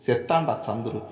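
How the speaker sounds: ordinary delivery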